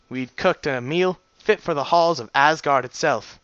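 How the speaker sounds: ordinary delivery, nothing marked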